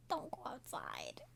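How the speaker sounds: slow whispered Don't go outside. Shown as a demonstration. Viewer warning the characters, a slow whisper. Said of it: "repeated as whisper".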